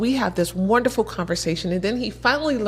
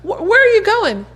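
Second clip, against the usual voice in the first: excited lady; 11 semitones higher than usual. Retold or imitated. Lady's excited questions.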